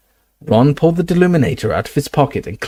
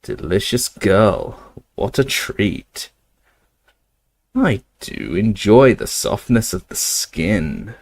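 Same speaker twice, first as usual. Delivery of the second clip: slow, leering rasping werewolf; slower than usual. Acted. Greyback leering at Hermione, slow and rasping. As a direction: savour every word, menacing.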